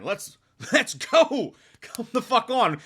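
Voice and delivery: high, exasperated hurry-up; impatient urging. Retold or imitated. Reviewer urging a slow scene along, impatient.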